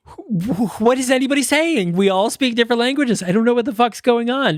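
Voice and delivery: mock bewilderment. Podcaster voicing passengers who speak different languages, mock bewilderment. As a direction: stammer in, then high-pitched confusion. Acts out characters lost among languages.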